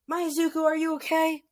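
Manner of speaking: high panicked mother voice; worried mom. Retold or imitated. Mother Inko corrects name to son, high worried call. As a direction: cry out like a frantic mother. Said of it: "I mean".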